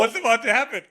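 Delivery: mock panic, high and loud; retold alarm. Client wondering what the masseuse intends, mock-panicked.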